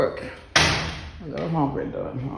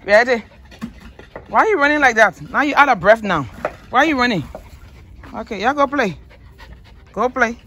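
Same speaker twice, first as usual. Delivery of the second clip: calling to the dogs; 7 semitones higher than usual. Real, not acted. Scolding running dogs playfully.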